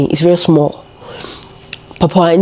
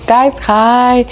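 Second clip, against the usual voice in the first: sing-song greeting; 6 semitones higher than usual. Real, not acted. Playful re-greeting.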